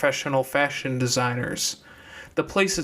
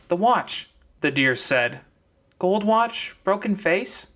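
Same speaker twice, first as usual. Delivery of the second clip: put-on high young girl's voice; deer girl's voice; 6 semitones higher, slower than usual. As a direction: pitch up into the deer girl. Deer asks about watch.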